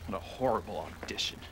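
whispered under his breath; glum whisper. Real, not acted. Boy muttering to himself after a failed audition, whispered. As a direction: hush it, disappointed with himself.

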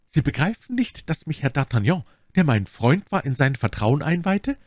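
a hint of an insistent lady; lightly in character, pressing her explanation; lightly voiced Milady confiding. Milady explains d'Artagnan's confidence, lightly acted insistence.

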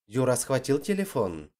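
ordinary delivery

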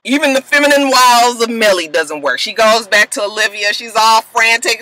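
normal talking voice

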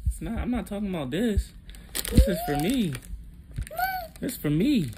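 high, swooping pitch. Real, not acted. Parent keeping something from toddler, high-pitched lilt. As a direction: explain to a little one in a high lilt. Playfully refusing the child.

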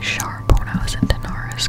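usual speaking voice

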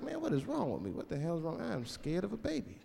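fretting quietly under his breath; soft inner worry, slowing at the end; hushed worry. Retold or imitated. Inner worried thoughts.

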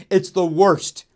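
half-joking loud complaint. Acted. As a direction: shout it at the club owners. Calling out comedy club owners.

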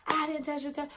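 high-pitched mimicry of a friend; mocking denial. Friend denies touching her forehead, high mocking imitation.

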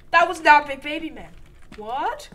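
high, loud terrified shouting; scared horror game streamer. Real, not acted. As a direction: yell in panic at the monster. Monster reveal in game.

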